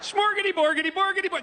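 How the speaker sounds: high, sing-song mock Swedish gibberish; Swedish Chef. Comic imitates a Muppet Show puppet, mock Swedish gibberish. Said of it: "was the Swedish Chef okay".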